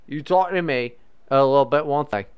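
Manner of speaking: put-on rough comic Scottish accent, slipping toward Irish. Comedian showing co-host a little Scottish, hammed-up Scottish accent. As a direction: lay on a choppy mock-Scottish brogue. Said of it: "You sound kinda Scottish there".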